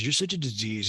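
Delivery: ordinary delivery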